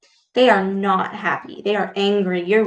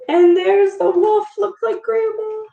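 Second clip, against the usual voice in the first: excited alarm; 12 semitones higher than usual. Real, not acted. Reader reacting to the wolf.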